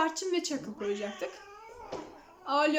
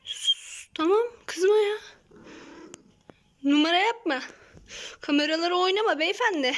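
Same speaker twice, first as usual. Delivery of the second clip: louder, mock telling-off of the cat; loud playful scolding; 3 semitones higher, 6 dB louder than usual. Real, not acted. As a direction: scold the pet in fun, voice up.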